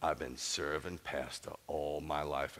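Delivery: put-on low, slow old Southern lady voice; imitating elderly churchwoman. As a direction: draw it out like an aged churchgoer. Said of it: "this is what she said. She goes".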